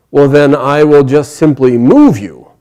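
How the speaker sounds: loud, slow, deliberate threat; forceful warning. Acted. Bhima warning the monkey he will move it, forceful. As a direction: declare it loudly, word by word.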